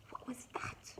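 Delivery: gasp, then whisper it softly; hushed, frightened gasp of a question; startled whisper. A narrator's reading. Story animals hear tapping at the door, startled whisper.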